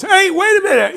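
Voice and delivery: high, loud call. Retold or imitated. Tony calls after the man leaving the diner. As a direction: stop him at the door.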